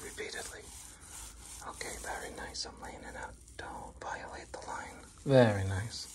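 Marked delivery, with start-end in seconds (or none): 0.0-5.1 hushed whisper while working; soft, whispered instructions. Real, not acted. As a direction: talk yourself through the job in a whisper. Whispers along while painting.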